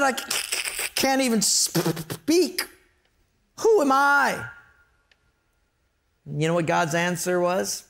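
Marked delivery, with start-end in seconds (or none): 0.0-2.7 high-pitched, hard stutter on first sounds; Moses stuttering. Acted. Moses telling God he can't speak, high stammer. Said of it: "he stuttered". 3.6-4.5 high-pitched, incredulous protest; disbelieving Moses. Acted. Moses answering God's call, high-pitched self-doubt.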